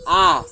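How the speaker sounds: loud, high, clearly sung-out vowel A; teacher modelling the vowel A loudly for a child; model vowel. Shown as a demonstration. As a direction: call out A big and open for the child to copy. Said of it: "Repeat after me ba?".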